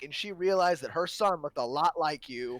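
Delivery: normal talking voice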